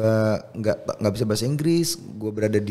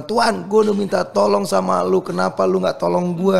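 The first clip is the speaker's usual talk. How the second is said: mock complaint; 8 semitones higher than usual. Dead man complaining to God.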